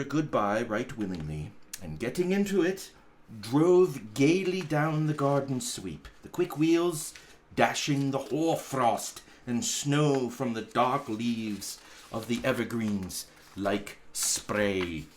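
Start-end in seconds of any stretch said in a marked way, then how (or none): none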